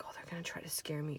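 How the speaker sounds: fast whisper to herself; whispered thought. Retold or imitated. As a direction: hush it, fast and unvoiced.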